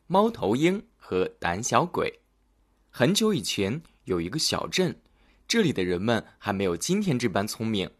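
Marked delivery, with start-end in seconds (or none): none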